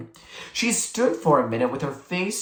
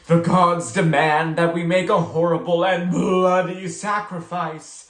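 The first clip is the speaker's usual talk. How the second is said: dramatic decree; 3 semitones higher, 4 dB louder than usual. A narrator's reading. April declaring a bloody sacrifice with relish, louder and dramatic. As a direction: savour the gore like a priestess. Ritual pronouncement in game.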